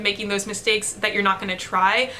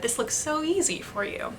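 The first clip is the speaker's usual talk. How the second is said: softer and higher, calm and unhurried; calm, easy reassurance; 6 semitones higher, 6 dB quieter than usual. Retold or imitated. Cello professor calmly encourages student mid-passage, soft and higher. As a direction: soothe a frustrated student with ease. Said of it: "he had a very calm demeanor".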